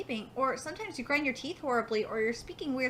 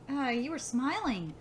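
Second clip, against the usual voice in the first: plain reading voice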